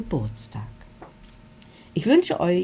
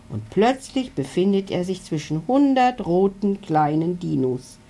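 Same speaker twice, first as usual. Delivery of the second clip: plain reading voice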